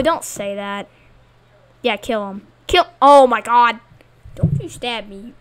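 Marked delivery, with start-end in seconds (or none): none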